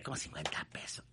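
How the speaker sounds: whispered aside; hushed whispered aside. Real, not acted. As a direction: whisper it like a secret to the mic. Aside about the taxi driver's tip.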